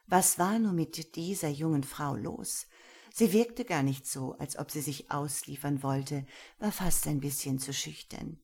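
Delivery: usual speaking voice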